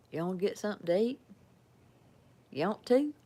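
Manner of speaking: quick Southern twang, pitch climbing at the end; acted Southern invitation. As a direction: slur it into one Southern question. Acting out a Southern exchange.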